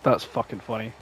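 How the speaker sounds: normal talking voice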